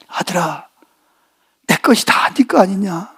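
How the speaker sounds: low, soft, slow fatherly reply; calm him with quiet love; father gently answering his resentful son; tender father. Retold or imitated.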